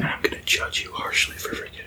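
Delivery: soft teasing whisper. Real, not acted. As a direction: whisper it as an aside. Whispered aside during recording.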